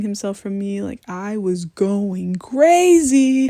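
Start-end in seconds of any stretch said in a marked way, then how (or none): 1.1-3.5 exasperated. Real, not acted. Exasperated retelling of the night.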